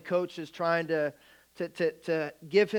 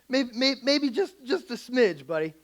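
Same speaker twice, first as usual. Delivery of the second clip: sheepish backpedal; 6 semitones higher, 3 dB louder than usual. Retold or imitated. Father backpedaling to his son.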